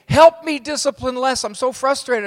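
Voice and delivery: mock frantic parent plea; pleading parent. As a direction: beg for help, exasperated. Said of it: "People will say to me".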